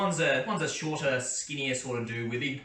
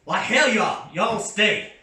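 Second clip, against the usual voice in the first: much higher, loud yokel yell, slow; yelling guard; 8 semitones higher, 9 dB louder, slower than usual. Acted. Burly guard yells after Cletus running off, loud drawn-out yell. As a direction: haul the wandering idiot back with a shout. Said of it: "the burlier bloke next to him goes".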